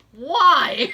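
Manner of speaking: mock-drunk loud, drawn-out question; lightly acted, loud balky drunk; drunk dad. Drunk dad balks at standing up.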